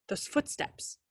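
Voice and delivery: breathy whisper, afraid to be heard; frightened whisper. A narrator's reading. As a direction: whisper it into the dark, scared. Scared girl at night.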